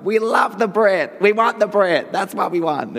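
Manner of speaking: high-pitched excited cheer, hammed up; gushing producers. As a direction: imitate over-keen execs gushing down the line. Acting out enthusiastic American producers phoning.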